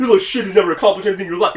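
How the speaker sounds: quick, lightly acted put-down; mock berating. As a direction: rattle it off as an imitated tirade. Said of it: "berating you".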